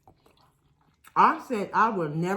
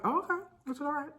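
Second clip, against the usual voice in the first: mock breezy okay; 9 semitones higher, 5 dB quieter, faster than usual. Told friend's husband denied it.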